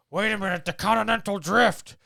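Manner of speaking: mock yell. Indiana Jones shouting at the villains over the continental drift. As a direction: bellow it like a furious hero who spotted their mistake.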